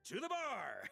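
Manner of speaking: high, jubilant call to the group; rallying cheer. Acted. Friend leads everyone off to drink, rallying cheer. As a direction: cry it out high like a battle cry.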